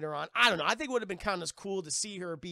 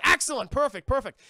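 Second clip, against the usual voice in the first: loud, high, hammed-up delight; mock-jubilant captain, loud and raised; delighted approval; 8 semitones higher, 11 dB louder than usual. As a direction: burst out with pleased approval. Captain's delighted reply to the parrot.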